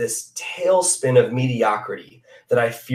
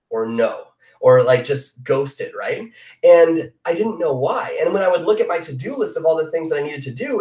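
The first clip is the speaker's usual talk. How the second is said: normal talking voice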